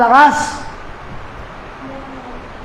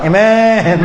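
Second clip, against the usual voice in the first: lower, drawn-out mock amen breaking into laughter; amen aimed at others; 4 semitones lower than usual. Preacher mimicking amens meant for someone else, teasing and drawn out. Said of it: "parang ina-amen sa iba".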